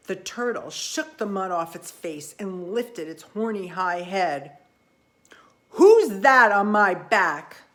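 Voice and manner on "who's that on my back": gruff demand. Acted. Turtle wakes, angry demand.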